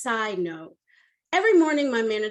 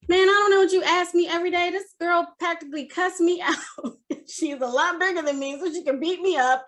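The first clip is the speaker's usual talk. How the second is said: emotional protest; 7 semitones higher than usual. Retold or imitated. Upset employee protests to her manager, emotional and worked up. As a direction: blurt it out worked up, pitch raised. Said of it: "I am emotional again, and I'm crying".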